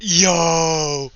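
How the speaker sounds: a sudden loud whoop; excited yell. Real, not acted. Streamer hearing the AI say mommy approves, loud whoop. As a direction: yell it out in flustered delight.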